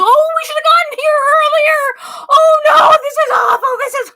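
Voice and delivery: high, loud wailing lament; a mother wailing in dismay. Retold or imitated. Mother wailing at being late to the party. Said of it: "She goes".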